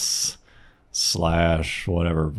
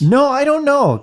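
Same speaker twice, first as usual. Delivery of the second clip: squeaky high; 4 dB louder than usual. Real, not acted. Joking reaction, squeaky.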